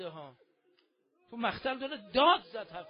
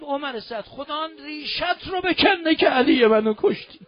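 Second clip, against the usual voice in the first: raised, higher cursing voice; angry curse; 4 semitones higher, 7 dB louder than usual. Acted. Imam cursing Omar Saad for his mockery, angry. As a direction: hurl the curse back at the enemy.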